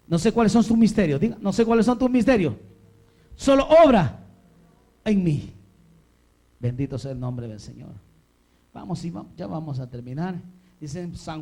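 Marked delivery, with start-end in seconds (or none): 0.1-5.5 loud, fervent declaration to repeat; full-force preaching. Real, not acted. Preacher gives the church words to pray, loud and fervent. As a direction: call it out to the congregation, very loud.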